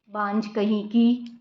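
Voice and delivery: low, slow, quiet taunt under the breath; muttered insult. Acted. Pregnant daughter-in-law mutters a taunt at her sister-in-law, quietly. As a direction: sneer it softly so she barely hears.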